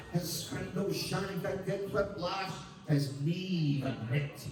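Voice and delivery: declaimed Burns poem with a Scottish lilt; poem recitation. Acted. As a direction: recite it like a Burns Night address.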